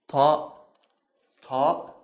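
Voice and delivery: stronger, throaty T sound; emphatic Arabic T. Shown as a demonstration. Teacher repeating the letter for learners, emphatic T. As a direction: open the throat on "ta". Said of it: "open the throat a little bit".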